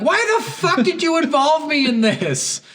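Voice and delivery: high, slow incredulous whine; exasperated mock whine. Player complaining to the gang member, high exasperated whine. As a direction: drag it out like you can't believe it. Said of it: "And then you're like".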